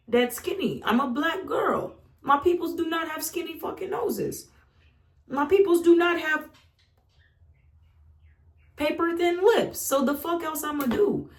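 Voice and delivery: talking herself out of the bullies' lies; defiant self-talk. Retold or imitated. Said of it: "I was like".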